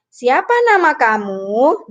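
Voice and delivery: drawn-out question tune on "Siapa nama kamu"; question intonation. Shown as a demonstration. Teacher showing pupils how spoken sentences carry tone, question intonation. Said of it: "itu ada nada".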